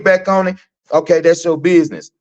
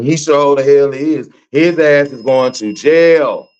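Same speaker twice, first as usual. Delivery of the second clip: ordinary talk, nothing marked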